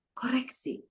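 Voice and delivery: "Koreksi" breathed as a whisper; soft unvoiced "Koreksi". Shown as a demonstration. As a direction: repeat "Koreksi" in a hushed whisper to let it sink in. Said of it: "whispered repeat of key word".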